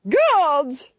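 a loud sudden call for the guards; shouted command. Acted. Duchess shouting to her guards, loud and commanding. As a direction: bark it out like a furious duchess.

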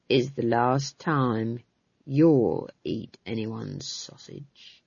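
low, very slow threat; trembling menace. A narrator's reading. Captain warning three frightened prisoners, low slow menace. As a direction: draw the warning out low and deliberate. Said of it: "He was trembling".

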